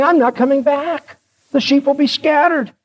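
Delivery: high-pitched acted grievance; mock dismay, voice kept high; aggrieved protest. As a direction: protest like an indignant king. Acted king protesting the prophecy.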